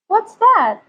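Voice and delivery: high and loud alarmed question; startled high query. Real, not acted. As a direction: snap to sudden alarm at the mirror. Spots a flaw in makeup.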